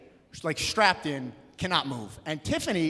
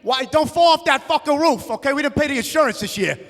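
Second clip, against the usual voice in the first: high, loud yell to the roof; dad yelling up at kids; 10 semitones higher, 8 dB louder than usual. Retold or imitated. Dad yells at kids on the roof, loud and high. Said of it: "He's like".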